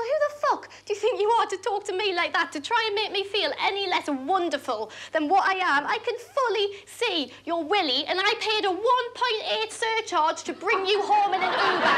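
high-pitched, performed outrage; indignant tirade. Acted. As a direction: build a righteous rant without shouting. Acted indignant tirade at the man.

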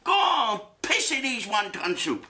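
squeaky mock accent, scheming; mock Chinese restaurant worker. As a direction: plot revenge on the customer in a silly high voice. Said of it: "They're gonna get a memo from him".

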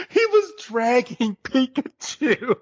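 talking through laughter, high and slow; laughing. Real, not acted. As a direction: squeal it out through real laughter. Laughing at the scene.

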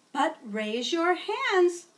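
teacher reminding pupils to raise hands; teacher reminder. Acted. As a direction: remind the class kindly. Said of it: "she would say".

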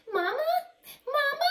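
high, rising gosling calls; baby goslings. Acted. Goslings following Bruce as mother, high and sweet. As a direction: cheep it like a baby goose.

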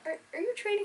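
high curious quote. Retold or imitated. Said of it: "I was like".

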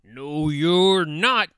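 loud, slow, menacing troll. A narrator's reading. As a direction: refuse loudly, drawing it out like a troll. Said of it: "said the troll".

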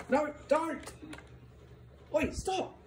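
ordinary delivery

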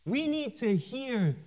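usual speaking voice

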